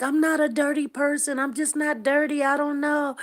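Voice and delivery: high, loud retold protest; protesting innocence. Daughter defending herself to her mom, high insistent protest.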